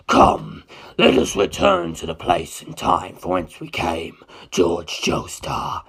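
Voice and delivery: a deep, unhurried ancient being; Carr's voice. Acted. Carr calms them, menacing.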